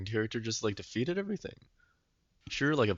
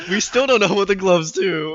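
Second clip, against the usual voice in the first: loud, high-pitched exasperated outburst; mad; 9 semitones higher, 12 dB louder than usual. Real, not acted. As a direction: burst out in laughing outrage. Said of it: "I'm so mad".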